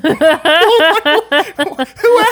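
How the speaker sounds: loud, high cackle of laughter; real laughing fit. Real, not acted. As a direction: burst out laughing hard. Cracking up at the corn line.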